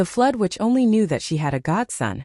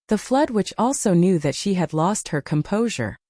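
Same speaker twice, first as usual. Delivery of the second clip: usual speaking voice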